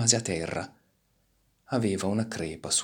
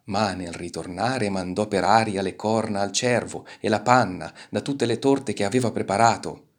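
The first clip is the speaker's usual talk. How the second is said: ordinary delivery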